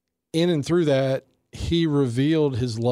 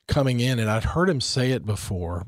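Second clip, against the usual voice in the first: ordinary talk, nothing marked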